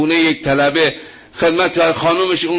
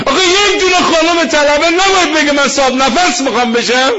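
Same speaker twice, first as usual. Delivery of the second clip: exclaiming preacher; 9 semitones higher, 3 dB louder than usual. Acted.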